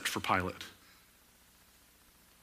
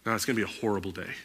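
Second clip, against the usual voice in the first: ordinary talk, nothing marked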